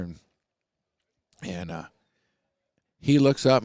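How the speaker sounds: normal talking voice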